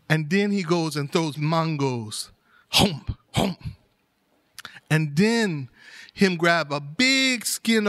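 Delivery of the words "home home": two short hollow chomps; imitated snake gulps. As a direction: gulp twice, with a beat between. Imitates the snake gulping food twice.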